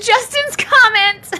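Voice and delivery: high laugh carrying the words; laughing out loud. Real, not acted. Laughing at a viewer's comment, high and amused. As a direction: laugh as you name it.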